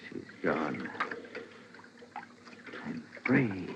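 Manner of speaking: husband alone after the drowning, hushed; hushed relief. Acted.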